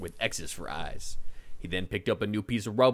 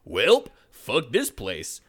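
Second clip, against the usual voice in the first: Braun's gruff voice; 2 semitones higher, 6 dB louder, much slower than usual. Acted. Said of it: "Braun said".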